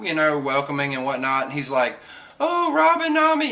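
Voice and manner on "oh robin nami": high-pitched delighted cooing; hammed-up high greeting; mock gushing greeting. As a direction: imitate an overjoyed character calling out to friends. Acted gushing greeting to crewmates.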